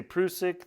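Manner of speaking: "Prusik" with a long oo, PROO-sik; alternative pronunciation. Shown as a demonstration. Allowing the other version, "Prusik" said PROO-sik. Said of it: "So if you say".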